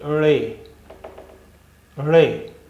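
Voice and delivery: रे said slowly and clearly, letter name; teacher saying रे for pupils to copy. Shown as a demonstration. Teacher names the letter रे for pupils. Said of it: "इस अक्षर का नाम है रे".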